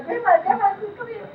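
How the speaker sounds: high-pitched imitation of a frantic kid; mock frantic calling; retold child's hollering. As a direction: call out urgently as a panicked child. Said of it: "she's hollering".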